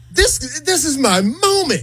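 high, drawn-out thrilled declaration; acted excitement. Al imagines fame from delivering the baby, acted excitement. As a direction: relive a big realization, slowly and high. Acting out his excited thoughts.

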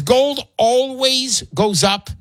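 slow, deliberate emphasis; slow emphatic quote. Retold or imitated. Host quoting Kevin O'Leary to caller Oscar, slow and emphatic. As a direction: lean on every word of the claim. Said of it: "He says".

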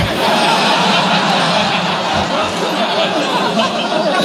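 airless laugh. Real, not acted. Audience laughter.

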